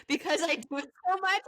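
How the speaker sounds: ordinary delivery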